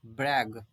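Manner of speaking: ब्रैग said brɛg, g not j; hard g ending. Shown as a demonstration. Teacher repeating the word, ब्रैग ending in g. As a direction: finish ब्रैग on a firm g. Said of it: "ग बोलेंगे".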